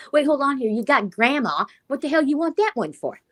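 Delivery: lightly hammed-up bewilderment; incredulous objection. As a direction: scold grandpa in mock disbelief. Acts out objecting to grandpa's choice.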